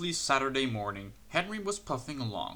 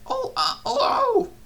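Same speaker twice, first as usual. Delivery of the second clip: very high, louder dismayed oh; groan; 7 dB louder than usual. A narrator's reading. Percy crashes into the luggage, high groaning dismay. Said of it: "groaned Percy".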